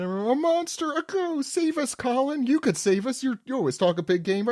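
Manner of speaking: put-on high, panicked children begging; high scared kids. Recapping manga to friends, frightened children pleading high.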